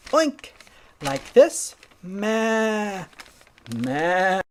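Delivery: high oink, then drawn-out bleats; pig imitating goat. Pig shows Goat what he learned, imitating bleats. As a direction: try out a bleat as a piglet. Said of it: "put them both together and you'll sound like".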